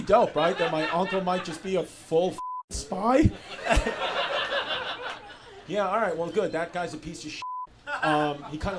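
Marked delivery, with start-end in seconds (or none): 3.3-5.3 high breathy laugh. Real, not acted. Laughs at own joke.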